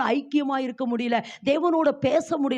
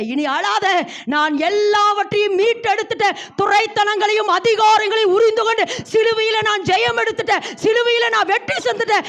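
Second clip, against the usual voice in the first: higher and louder, ringing victory; triumphant proclamation; 8 semitones higher, 5 dB louder than usual. Acted. Jesus declaring victory over sin to the woman, loud and triumphant. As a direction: proclaim the win with full force. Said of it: "ஏசப்பா ... சொல்றாரு".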